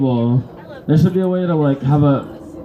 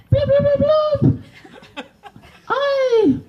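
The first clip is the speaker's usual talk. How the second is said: very high squeaky fish gurgle; high fish blubs; slower than usual. Acted. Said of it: "a fish".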